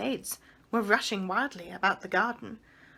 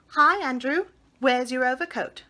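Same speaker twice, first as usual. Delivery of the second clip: boy mimicking a fussy lady, higher and louder; high windy lady's voice, called out; mimicking Miss Lark; 4 semitones higher, 6 dB louder than usual. Michael mocks Miss Lark calling her dog, high and windy. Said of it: "trying to make a high, windy voice like Miss Lark's".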